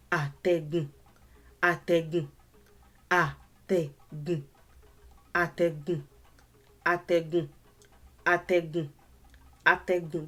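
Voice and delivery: Atẹ́gùn said over and over, each tone placed; Yoruba word repeated. Shown as a demonstration. Teacher drilling a Yoruba word, repeating it for learners. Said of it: "That's how to say the word properly".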